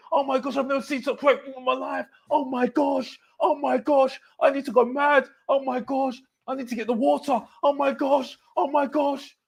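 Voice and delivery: high, squealing mock excitement; mocking overexcited fan. Vlogger mocks fans gushing over Postecoglou, shrill hammed-up excitement. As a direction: ham up a gushing fan losing it. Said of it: "all of a sudden, Ange Postecoglou".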